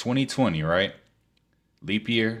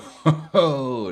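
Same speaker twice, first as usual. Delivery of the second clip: burst of laughter; 6 semitones higher, 8 dB louder than usual. Real, not acted. Laughing at the clip.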